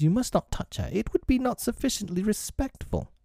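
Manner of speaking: put-on forbidding boy. A narrator's reading. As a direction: forbid it primly, pitched well up. Peter forbids touching Wendy.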